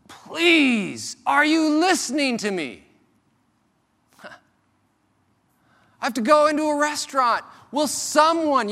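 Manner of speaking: high, loud pleading cry; desperate man. Retold or imitated. Vulgar man at restaurant pleading to be heard, desperate. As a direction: beg to be heard, straining. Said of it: "a desperate man saying".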